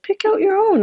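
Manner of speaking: high, sugary lure; sweet false invitation. Acted. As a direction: coax him to lean in. Stepmother offers the chest.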